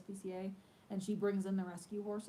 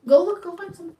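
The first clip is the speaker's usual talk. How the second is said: calling out; 10 semitones higher, 19 dB louder than usual. Real, not acted. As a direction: send her off with a loud, high call. Calling child to kitchen.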